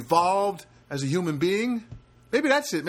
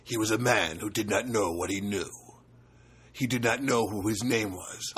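much lower, booming movie-trailer narrator; deep-voiced trailer guy; 7 semitones lower than usual. Host mocking later movie trailer guy, deep booming voice. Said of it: "the guy with the deep voice".

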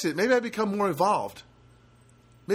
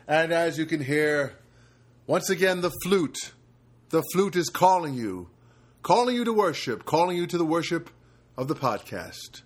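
fifties voiceover guy. Acted. Podcast host opening the show as a fifties voiceover announcer. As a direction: open the show like a fifties movie narrator. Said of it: "Why am I talking like this? Like one of those fifties voiceover guys".

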